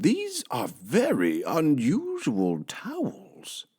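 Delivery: high, slow, baffled; Mister Rogers asking the maid, puzzled. Acted. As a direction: ask it bewildered. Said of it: "he called".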